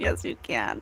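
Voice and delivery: soft low murmur of a spirit's answer; hushed spirit voice. Retold or imitated. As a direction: hush it like a voice from beyond. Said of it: "Spirit said".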